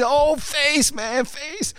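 high-pitched mock schoolyard hype; imitated crowd reaction. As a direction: play the kids going crazy over a burn. Imitates crowd going crazy.

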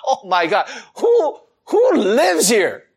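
mock disgusted shock. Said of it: "I was going".

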